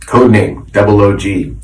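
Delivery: usual speaking voice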